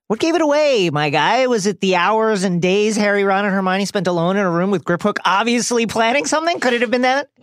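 high, mocking rhetorical questions; sarcastic. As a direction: tease how obvious it was. Mocking the obvious plot.

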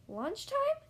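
high rising question on "Lunchtime"; perked-up surprise. A narrator's reading. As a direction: perk up hopefully, pitch leaping at the end. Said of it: "Gerald perks up".

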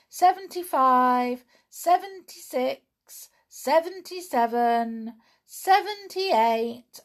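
high, loud sing-song counting. A narrator's reading. Tom counts loudly in hide and seek. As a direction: call the numbers so all can hear. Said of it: "Tom counted out loud".

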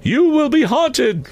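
very high, slow spectral pronouncement; foreboding ghost. A narrator's reading. Ghost announcing the haunting to Scrooge, foreboding high voice. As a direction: intone it high and drawn, like a doom. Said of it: "resumed the ghost".

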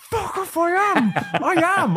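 very high-pitched mock yell; squealed comic protest; retold angry retort. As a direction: yell back indignantly, hammed up for the story. Acts his fuming answer back at coach.

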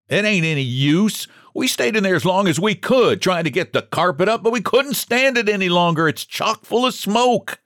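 high, urgent protest; mild shout, pitched well up. A narrator's reading. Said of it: "The man shouted it out".

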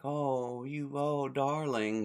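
sleepy lady. Retold or imitated. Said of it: "talking in her sleep".

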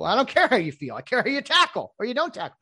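mock tough coach. Coach answering the player.

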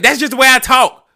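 high, loud brash reply; loud sassy retort. Retold or imitated. Customer mimics the cashier defending her tone, loud. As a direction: snap back like the cashier. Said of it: "She's like".

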